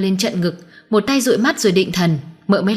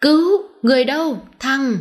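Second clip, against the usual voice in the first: higher-pitched urgent call for help; cry for help; 6 semitones higher than usual. A narrator's reading. Old man calls for help as he is attacked, alarmed cry.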